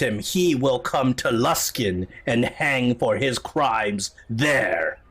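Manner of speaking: slow, weighty accusation; imperious admiral. Acted. Admiral accusing a pirate before the court, slow and imperious. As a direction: denounce him in drawn-out, measured words. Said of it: "I am Admiral Lacroix".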